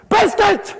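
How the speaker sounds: high, loud shouted demand; shouted drill. Shown as a demonstration. Parent yelling the word at a child, loudly insistent. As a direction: yell it at the child. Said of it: "I've just deafened Alan".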